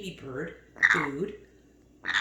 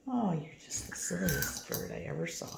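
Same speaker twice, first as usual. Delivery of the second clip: whispered, teasing softly; fond whisper; 6 dB quieter than usual. Real, not acted. Owner teasing her silly parrot, soft whisper. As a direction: breathe it to the bird fondly.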